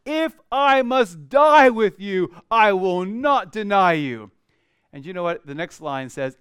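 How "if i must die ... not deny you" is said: loud, slow, doubling down; emphatic. Acted. Peter doubling down to Jesus, loud and emphatic. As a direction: plant your feet and swear it. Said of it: "But he said emphatically".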